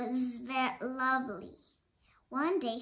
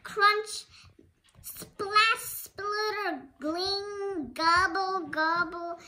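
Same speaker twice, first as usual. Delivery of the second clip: high loud voiced crash noises; sound effects; 6 semitones higher, 7 dB louder, much slower than usual. Child acting out kitchen chaos, high loud noises. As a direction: play out a crashing mess with your voice.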